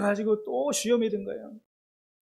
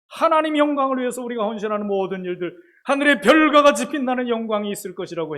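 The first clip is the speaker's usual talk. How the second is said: louder, higher sermon delivery; full-force preaching; 6 semitones higher, 9 dB louder than usual. Acted. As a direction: preach it with conviction.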